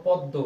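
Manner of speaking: "পদ্ম" as pod-do, with no m; doubled d. Shown as a demonstration. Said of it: "double উচ্চারণ হয়েছে".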